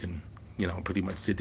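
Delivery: ordinary talk, nothing marked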